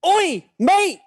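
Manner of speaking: loud, high Australian call; Australian accent. Acted. American guest reading his script for an Australian accent challenge, loud Australian accent. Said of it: "your best impersonation".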